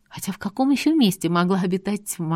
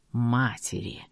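eerie djinn voice; 7 semitones lower, 5 dB quieter, slower than usual. A narrator's reading. Djinn speaks in tower.

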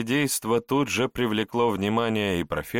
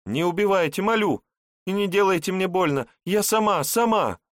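high, frantic terrified begging; fluttering cries for help; 11 semitones higher, 4 dB louder than usual. A narrator's reading. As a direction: plead high and breathless for mercy. Terrified girl begs for life.

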